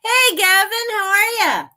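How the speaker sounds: bright, loud, high call to a friend; excited greeting. Real, not acted. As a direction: sing out a hello to Gavin. Greeting a chat viewer.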